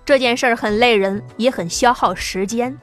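ordinary delivery, nothing marked

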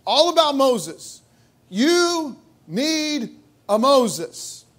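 drawn out, each word leaned on; slow and pointed, stressing Moses; emphatic, slow. Shown as a demonstration. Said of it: "The emphasis is all about".

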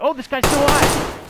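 sudden high, loud alarm; startled cry. Real, not acted. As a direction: jolt at the sudden danger. Surprised the enemy still lives.

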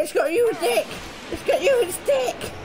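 high alarmed cry rising; panicked cry. Real, not acted. Player crying out as the monster grabs his mate, panicked.

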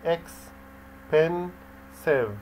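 "Expensive" very slowly in three beats, ex, pen, sive; long gaps inside "Expensive": ex - pen - sive; split into syllables. Shown as a demonstration. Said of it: "دي فيها three syllables".

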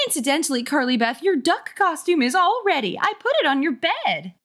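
high-pitched, breezy mom announcing news; chirpy mother. Acted. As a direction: sing out like an eager mom.

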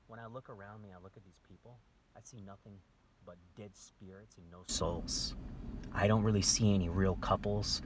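ordinary talk, nothing marked

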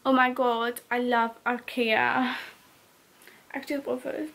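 ordinary talk, nothing marked

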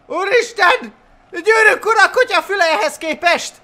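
loud, high exclamation of amazement; excited awe. Real, not acted. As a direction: blurt it out thrilled, high and loud. Awed by huge battle.

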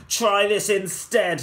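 loud mocking shout; yelling. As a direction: yell it as the sword swings. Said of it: "the prince yelled back".

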